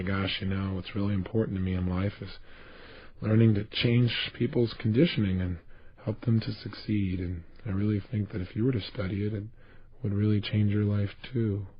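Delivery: low, soft, uninspired delivery; dispassionate. Shown as a demonstration. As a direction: convince someone without believing a word of it. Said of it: "a dispassionate way".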